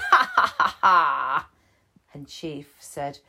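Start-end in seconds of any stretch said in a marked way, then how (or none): 0.1-1.5 a mischievous bear laughing; cheeky laugh. Acted.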